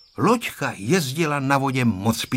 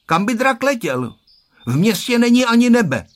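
put-on high, loud scornful child; contemptuous kid, pitched way up and growing louder; 11 semitones higher, 6 dB louder than usual. A narrator's reading. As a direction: scoff in a raised boy's voice. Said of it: "řekl pohrdlivě".

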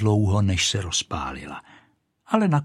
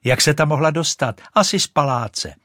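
put-on excited boy's cry; 6 semitones higher, 6 dB louder than usual. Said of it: "vykřikl jeden ten klouček".

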